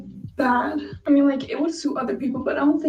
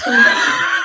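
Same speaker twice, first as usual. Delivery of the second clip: laughing in despair; 8 dB louder than usual. Real, not acted. Upset over a ruined haircut.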